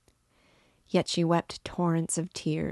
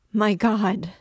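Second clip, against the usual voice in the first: higher, louder cry of astonishment; raised-voice outburst of shock; shocked exclamation; 4 semitones higher, 6 dB louder than usual. A narrator's reading. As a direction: cry out, stunned and overcome.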